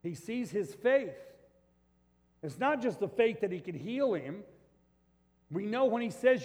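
loud, high preaching voice, driving each point home; fiery preaching. Acted. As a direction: preach it out to the whole room with full force. Preaching at full force.

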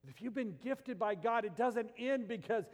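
impassioned preacher voice; preaching at full force. Acted. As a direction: drive it home to the pews.

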